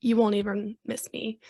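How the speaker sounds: ordinary talk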